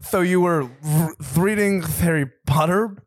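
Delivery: slow lisping boxer impression; halting, lisped celebrity voice; Mike Tyson. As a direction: draw it out with a thick fake lisp. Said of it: "Mike Tyson".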